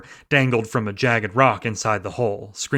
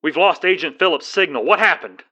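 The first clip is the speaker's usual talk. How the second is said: a touch higher, urgent caller; lightly voiced dispatcher; dispatch voice on phone; 6 semitones higher, 4 dB louder than usual. A narrator's reading. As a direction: dispatcher asking about the lost signal. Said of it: "the voice on the phone asked".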